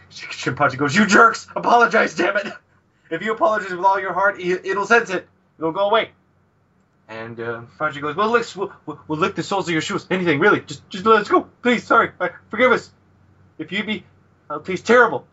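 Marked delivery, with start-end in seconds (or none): 1.0-6.1 high, urgent mock-frantic voice; panicked scolding. Shinpachi makes friends apologize to a demon, panicked scolding. 8.1-15.3 high-pitched, stumbling mock plea; panicked begging. Shinpachi begs the demon for forgiveness, panicked pleading.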